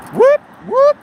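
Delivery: high whooping siren noise; police siren imitation. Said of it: "this is sound of the police".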